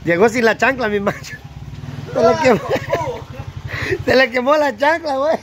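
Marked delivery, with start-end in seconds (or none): 2.1-5.4 laughing teasing. Real, not acted.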